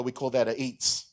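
normal talking voice

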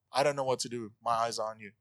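low, quiet, defeated mock prayer; resigned last resort. Preacher imitating those who pray last, resigned and low. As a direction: give up softly, as if nothing else is left.

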